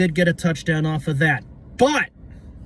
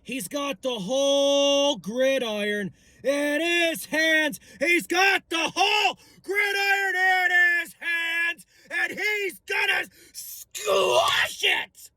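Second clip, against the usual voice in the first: much higher, slow, drawn-out shout; slow roaring hype; 11 semitones higher, slower than usual. Acted. Fan bellowing that Hurts holds the gridiron. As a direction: stretch every word like a war cry. Ecstatic praise of quarterback.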